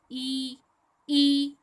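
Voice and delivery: ordinary talk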